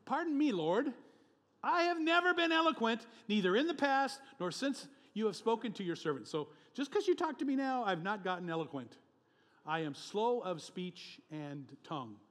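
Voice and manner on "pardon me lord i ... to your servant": high, strained pleading voice, much higher and louder; pleading Moses. Acted. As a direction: whine your excuse to God like a nervous Moses. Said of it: "He says".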